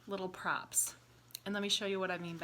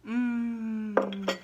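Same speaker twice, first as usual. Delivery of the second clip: yummy mm; 2 semitones higher, 5 dB louder than usual. Acted. Drinking hot cocoa.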